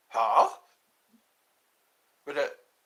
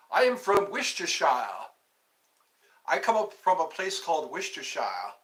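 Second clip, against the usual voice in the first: hammed-up English accent, dropped r's in Worcestershire; British accent. Vlogger imitating someone from an English place, British accent. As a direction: put on a mock British accent. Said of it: "It sounds like an English, you know, a British".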